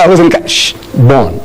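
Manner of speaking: normal talking voice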